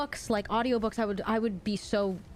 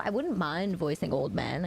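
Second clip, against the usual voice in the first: ordinary talk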